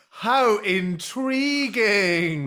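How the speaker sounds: drawn-out, mock-intrigued musing; slow, put-on fascination; mock intrigue. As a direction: stretch it out as if deeply fascinated. Mock pondering reaction to the story.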